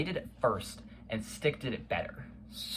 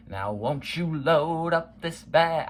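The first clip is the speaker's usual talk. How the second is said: imitating a growled rap line, higher, louder and slow; mock growl; 6 semitones higher, 7 dB louder, slower than usual. Fan imitates the rapper's growled lyric, hammed-up growl. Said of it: "like he growls it almost".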